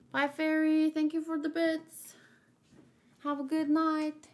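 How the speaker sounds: ordinary delivery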